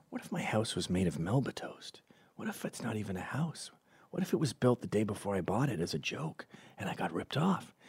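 hushed, whispered late-night worrying; paranoid whisper. Acted. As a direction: whisper anxious thoughts to yourself in bed. Paranoid thoughts while falling asleep.

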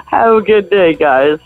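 normal talking voice

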